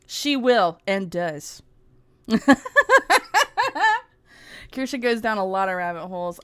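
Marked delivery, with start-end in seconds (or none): 2.3-4.0 sudden high-pitched giggle; a bright burst of laughter. Real, not acted. Streamer giggling at her own joke to chat.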